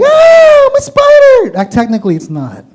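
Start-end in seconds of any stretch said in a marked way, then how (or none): 0.0-1.6 put-on high shrieking kid voice; screaming kid. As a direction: shriek like a scared child. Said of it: "when kids see them, they're like".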